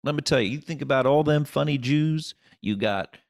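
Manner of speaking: Kentucky booker. Retold or imitated. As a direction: ham up a gushing small-town booker. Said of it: "He goes".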